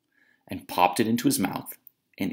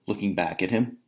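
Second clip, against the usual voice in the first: plain reading voice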